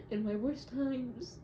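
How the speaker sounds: ordinary delivery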